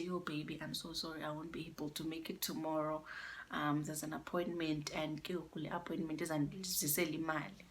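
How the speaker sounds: hushed and sorry, as she said it; softly pleading girlfriend; soft apology. Retold or imitated. Girlfriend cancels plans, voiced softly.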